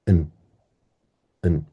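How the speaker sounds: "n" as a quick weak un; tiny short un for "n"; unstressed schwa. Shown as a demonstration. Teacher showing last syllable, brief schwa un. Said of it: "this one is schwa".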